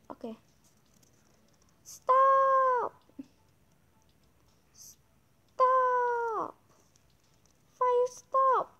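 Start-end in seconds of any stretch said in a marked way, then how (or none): 1.8-8.7 yelling. Real, not acted. Fire spreading in game.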